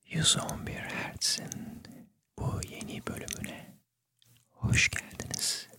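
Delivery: soft unvoiced whisper into the mic; ASMR whisper. Shown as a demonstration. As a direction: breathe it close to the microphone. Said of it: "Neden böyle konuştuğumu biliyor musunuz?".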